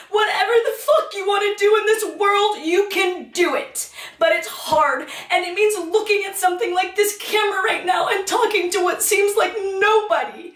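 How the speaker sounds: real emotion spilling over, laughing through it; overwhelmed with emotion. Real, not acted. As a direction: swept up, laughing and nearly shouting. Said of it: "I get so overwhelmed with emotion".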